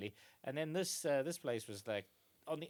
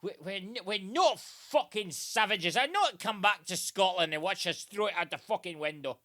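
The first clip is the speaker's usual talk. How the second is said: higher, louder ranting father, still half-laughing; outraged Scottish dad; 4 semitones higher, 9 dB louder than usual. Retold or imitated. Dad insisting windows are civilized, loud mock rant. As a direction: shout it like a scandalised Scot. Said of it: "to his dad".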